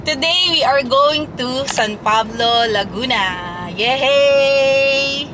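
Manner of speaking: slow, drawn-out announcement ending in a loud cheer; excited trip announcement. Real, not acted. Vlogger announcing a trip to San Pablo, excited. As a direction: announce it big, then a long cheer.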